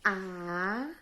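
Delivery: teacher sounding out "A" for the class; isolated syllable. Shown as a demonstration. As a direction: stretch a lone "A" upward. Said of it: "que eu falo sozinha".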